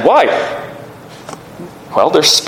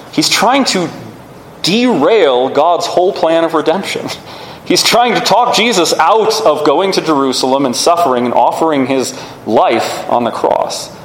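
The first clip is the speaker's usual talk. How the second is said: ordinary talk, nothing marked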